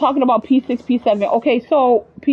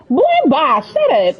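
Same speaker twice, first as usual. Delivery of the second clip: higher, sassy brush-off; sassy dismissal; 5 semitones higher, 4 dB louder, slower than usual. Acted. Player answering back to an ex-boyfriend, sassy dismissal. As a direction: cut them off with sass.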